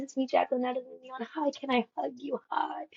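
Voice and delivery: soft, rushed, starstruck; hushed nervous fangirl; shy soft voice. Retold or imitated. Tiptoed over to [name].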